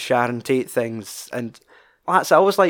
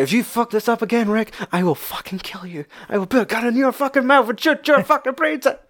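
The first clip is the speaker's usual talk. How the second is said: higher, seething threat at his reflection; furious self-threat; 10 semitones higher than usual. Retold or imitated. Actor threatening his own reflection, higher and furious. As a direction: threaten yourself in the mirror. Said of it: "he's angry at himself".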